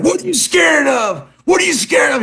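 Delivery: put-on drunk shouting. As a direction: yell it like a bully. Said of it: "He's like".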